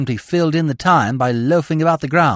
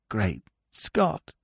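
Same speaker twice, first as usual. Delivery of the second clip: hoarse whisper; 5 semitones lower, 7 dB quieter than usual. Acted. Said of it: "he whispered hoarsely".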